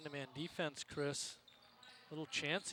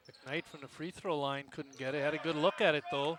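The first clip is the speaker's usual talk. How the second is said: louder play-by-play as the action builds; excited play-by-play; 11 dB louder than usual. Real, not acted. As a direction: call the rebound with rising excitement.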